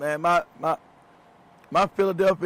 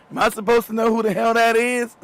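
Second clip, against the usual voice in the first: higher, louder retold disbelief; mock indignant; 5 semitones higher, 5 dB louder than usual. Telling friend he never knew the singer, mock indignant. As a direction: protest comically at being expected to know. Replaying his indignant, clueless reply.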